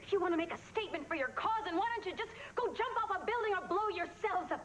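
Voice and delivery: high-pitched, indignant rant; angry tirade. Acted. Freed hostage berating the captors, high angry tirade.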